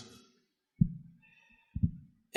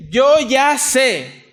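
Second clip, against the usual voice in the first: drawn-out, higher, louder boast; smug know-it-all; 7 semitones higher, 4 dB louder, much slower than usual. Acted. Preacher mimics a boastful believer, smug and drawn-out. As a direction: play the proud one who thinks he knows it all. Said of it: "y dijera".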